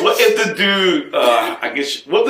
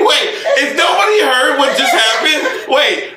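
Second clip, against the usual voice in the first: laughing shout; 9 semitones higher than usual. Real, not acted. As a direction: shout it while cracking up.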